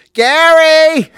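high, piercing drawn-out scream; Richard Simmons screaming. Acted. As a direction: scream the name out at the very top. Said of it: "I could just do him screaming Gary".

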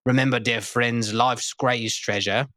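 grand Shakespearean herald voice; hammy stage actor. Acted. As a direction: proclaim it like a town crier. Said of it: "O thou great and mighty Shakespearean spark".

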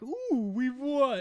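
high, slow smug gloat; gloating villain. Retold or imitated. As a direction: savor the win smugly.

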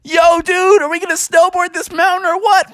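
high-pitched stoked dude voice; high surfer dude. Acted. Froggy calling to Iggy on the mountain, high surfer voice. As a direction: hype your buddy up for the run.

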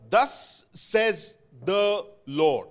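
slow, weighty proclamation; proclaiming. Acted. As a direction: declare it like a prophet. Preacher proclaiming God's word.

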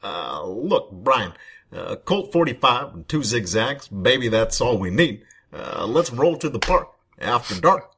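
halting mock-presidential voice with swooping pitch; Obama. President answering an interviewer's question, mock-statesmanlike delivery. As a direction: play a president answering in punchy, broken phrases. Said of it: "Mister Obama, what do you like doing more".

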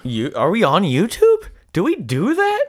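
high questioning lift on "YouTube". Pretend. Host playing unsure about the show's YouTube, exaggerated question. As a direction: push "do that" way up in exaggerated doubt. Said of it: "saying it like a question".

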